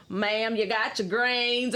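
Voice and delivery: drawn-out, exaggerated Mississippi accent; mock Mississippi accent. Cab driver showing two visitors a Mississippi accent, mock drawl. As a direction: imitate a slow Mississippi drawl. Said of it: "You don't have the Mississippi accent".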